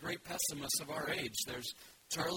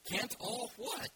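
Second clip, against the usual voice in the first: high, slow, puzzled little bear; puzzled Pooh; 8 semitones higher, slower than usual. Acted. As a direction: ask like a confused little bear. Pooh voiced in story.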